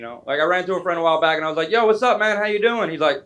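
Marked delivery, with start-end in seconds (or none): 1.7-2.9 higher, bright over-eager hello; eager greeting. Retold or imitated. Comedian greeting an old friend he ran into, eager. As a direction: pitch it up like you're thrilled to see him.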